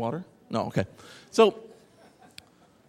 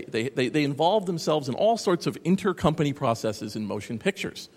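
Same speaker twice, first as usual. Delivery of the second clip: ordinary talk, nothing marked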